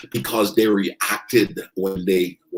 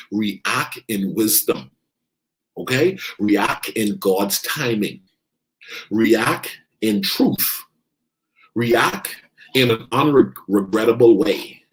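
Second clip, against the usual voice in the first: slow emphatic list; slower than usual. Acted. Slow list of ways to react.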